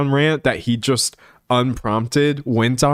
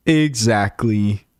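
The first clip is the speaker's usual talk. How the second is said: slow, stretched Exactly; drawn-out exactly; much slower than usual. Real, not acted. Streamer agreeing with a chat comment, slowly stretched out.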